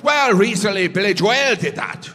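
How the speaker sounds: Irish pronunciation. Retold or imitated. Irish sound guy correcting comic, mild Irish lilt. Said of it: "And he goes".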